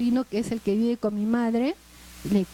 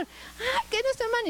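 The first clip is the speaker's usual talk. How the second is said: whiny sister; 11 semitones higher, 4 dB quieter than usual. Retold or imitated. Sister mocking reply.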